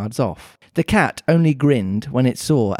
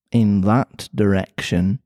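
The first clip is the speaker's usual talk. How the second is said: put-on Mancunian accent; 5 semitones lower, slower than usual. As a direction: lower Mancunian cat voice. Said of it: "pretty Mancunian sounding accent".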